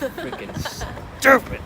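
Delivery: high, loud frustrated exclamation; annoyed outburst. Real, not acted. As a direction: blurt it out, fed up. Frustrated at the cold.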